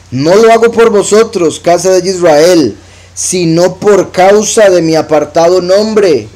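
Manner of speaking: slow, solemn reading of the verse; scripture reading. Acted. Preacher reads God's words to the congregation, slow and solemn. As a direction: lift the verse, slow and weighty.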